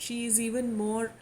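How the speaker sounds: ordinary talk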